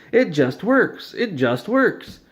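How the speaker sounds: insistent mock catchphrase. Todd insisting again.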